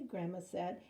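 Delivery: usual speaking voice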